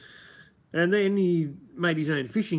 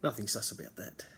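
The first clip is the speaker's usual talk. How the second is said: sly whisper; 2 semitones lower, 7 dB quieter than usual. Real, not acted. Sarcastic aside about Rex.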